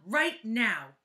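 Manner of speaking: slow, loud teacher's yell. Acted. Teacher yelling at Shari over her notebook, loud and slow. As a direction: bark it out as a sudden, slow command. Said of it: "yelled Missus Drizzle".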